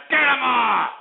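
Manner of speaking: loud, high bellowed dismissal; sudden explosive yell; angry shout. Real, not acted. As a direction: yell the order in exasperation. Host throws caller off air.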